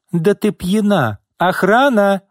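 high-pitched angry yell. A narrator's reading. Boss calls security on her secretary, yelling. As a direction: yell for help in outrage. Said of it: "завопила Изольда".

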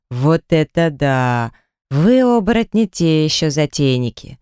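plain reading voice

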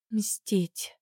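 a soft, breathless word; whispered. A narrator's reading. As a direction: whisper it in dread. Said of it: "прошептала".

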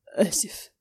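choked, whispered sorry; barely voiced after crying; tearful apology. A narrator's reading. He apologises to her after crying, tearful whisper.

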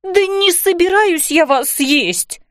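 high, slow indignant denial; exasperated protest. Acted. Fairy mum reassuring man she won't eat him, exasperated. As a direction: protest high, dragging the words.

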